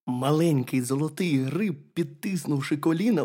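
ordinary delivery, nothing marked

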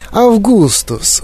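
high, drawn-out delighted cry of a name; exclaiming. A narrator's reading. Factory owner greets the first boy, high exclamation. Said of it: "вигукнув містер Вонка".

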